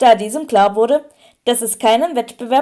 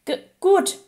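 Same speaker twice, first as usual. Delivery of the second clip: higher, halting little answer; hesitant stammer; 7 semitones higher than usual. A narrator's reading. Harry answering Lupin's how are you, stammering. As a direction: stumble on the first sound.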